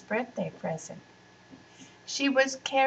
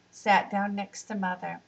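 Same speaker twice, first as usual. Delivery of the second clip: ordinary delivery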